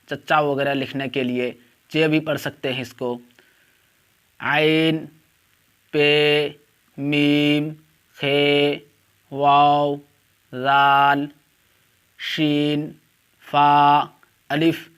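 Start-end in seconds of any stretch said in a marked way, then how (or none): 4.4-14.9 each letter called out loud and separate; alphabet recitation. Shown as a demonstration. Teacher calling out Urdu letters to online students, loud and deliberate. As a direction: drill the letters slowly for the class.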